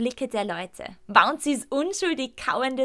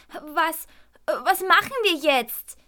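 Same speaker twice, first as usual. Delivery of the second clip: put-on worried child; 7 semitones higher, 5 dB louder, slower than usual. A narrator's reading. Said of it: "Elias sieht besorgt zu mir hoch".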